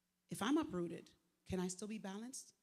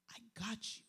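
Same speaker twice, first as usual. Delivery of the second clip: whispered reassurance; 2 semitones higher, faster than usual. Retold or imitated. Speaker recalling Jesus calming her, whispering.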